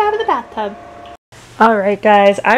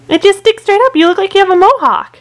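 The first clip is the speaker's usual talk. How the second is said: delighted; 9 semitones higher, 6 dB louder than usual. Acted. Mom amused at baby hair.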